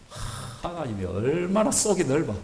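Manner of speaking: ordinary delivery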